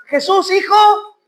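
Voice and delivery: high, drawn-out call; crying out. Acted. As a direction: stretch the name out as a call. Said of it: "clamaba más".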